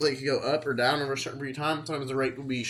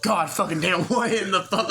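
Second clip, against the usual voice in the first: higher, louder muted-mic swearing; exasperated outburst; 6 semitones higher, 4 dB louder than usual. Retold or imitated. Coworker swearing after muting his mic, higher and louder. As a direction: blurt out disbelief at the caller. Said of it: "you had to mute his mic".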